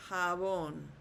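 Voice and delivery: "jabón" as ha-BON, J as H; Spanish "jabón", H start, long final syllable; correct Spanish jabón. Shown as a demonstration. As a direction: slowly say "jabón", stressing the accented O. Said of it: "an accent mark over the O".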